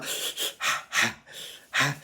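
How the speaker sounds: breathy voiceless hot hot hot; whispered hiss hot. Retold or imitated. Son in the bathroom, a pained whispered hiss. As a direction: hiss each hot in pain.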